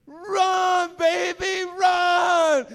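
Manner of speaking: high, full-voiced holler with long vowels; imitating mama's big urgent shout; mama calling. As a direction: cheer on your child from far away.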